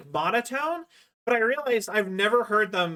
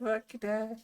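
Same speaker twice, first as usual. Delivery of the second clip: imitating a clip; 3 semitones higher, 7 dB quieter than usual. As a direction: parrot the clip in a silly voice. Repeats clip in put-on voice.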